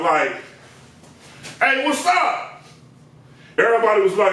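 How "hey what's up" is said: high, slow drawn-out greeting; imitating his greeting. Comic telling the barbershop story, mock drawn-out hello. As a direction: mimic the customer walking in.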